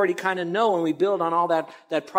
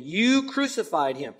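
You crucified him, slow with YOU leaned on; stress on YOU; slower than usual. Shown as a demonstration. Preacher telling the crowd they crucified him, stress on You. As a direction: press hard on the word You, slow and heavy. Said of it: "underline the word "you".

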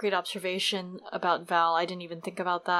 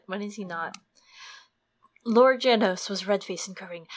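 normal talking voice

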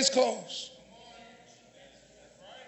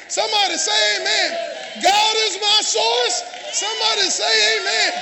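loud, raised preacher's cadence; full-force preaching; 4 semitones higher, 4 dB louder than usual. Real, not acted. Preacher rejecting reparations before the congregation, full-force preaching. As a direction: drive each phrase home to the congregation.

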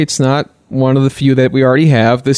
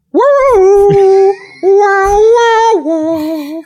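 high, loud siren wail; siren imitation; 3 dB louder, much slower than usual. Siren noise to announce the story.

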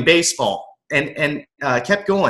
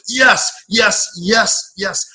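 inner triumphant cheer; 6 semitones higher, much slower than usual. Retold or imitated. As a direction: cheer yourself on after finally keeping up. Silently cheering on the inside.

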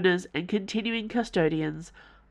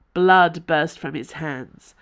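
ordinary delivery

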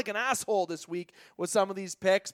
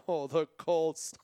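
amused laughing; slower than usual. Real, not acted. Laughs at his own pick.

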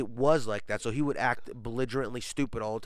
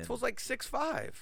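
amazed; 12 semitones higher, 5 dB quieter than usual. Real, not acted. Marvelling at rapper's size.

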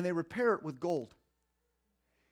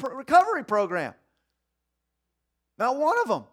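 loud, higher fervent preaching; preaching at full force; 8 semitones higher, 12 dB louder than usual. Acted. Preacher rousing the congregation about broken men. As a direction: build it up and drive it home.

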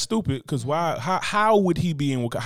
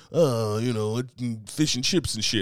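lightly put-on British accent, rambling; a hint of a British interviewee; mock British accent; slower than usual. Mimicking the actor's real British accent in an interview, lightly put on. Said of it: "he gets an interview".

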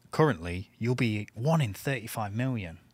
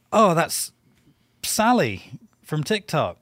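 mock announcer voice; 6 semitones higher, 4 dB louder, slower than usual. Mock TikTok user announcement.

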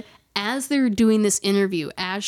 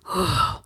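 a low, breathy sigh, lightly done; imitated ghost sigh; 5 semitones lower than usual. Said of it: "did anybody else hear, like".

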